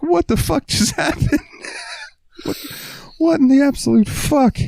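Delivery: very high, laughing "what the fuck"; laughing disbelief. Retold or imitated. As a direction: squeal in stunned disbelief. Staff reacting in disbelief.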